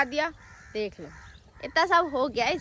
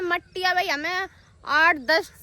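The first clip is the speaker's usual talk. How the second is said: normal talking voice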